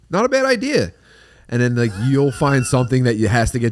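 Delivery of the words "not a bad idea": high, sing-song agreeing wife; mock wife agreeing. Wife falling for the nacho trick, high and sing-song. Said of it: "she'll go".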